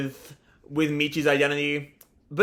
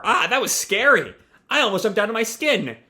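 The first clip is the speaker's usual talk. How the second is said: higher-pitched mock fright; startled; 6 semitones higher than usual. Sumika after jumping at the lightning, acting startled. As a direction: exclaim as if just given a jump, hammed-up. Acted reaction to lightning scare.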